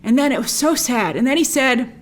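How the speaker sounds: usual speaking voice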